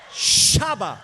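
loud, explosive mock-Pentecostal shout; mock spiritual outburst. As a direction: burst out as if seized by the Spirit. Mock Holy Ghost outburst over tea.

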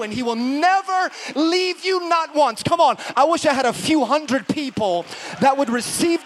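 loud, high-pitched, driving sermon delivery; full-force preaching. Real, not acted. Preacher encouraging the church that God is with them, loud and impassioned. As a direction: build phrase on phrase and proclaim it to the room.

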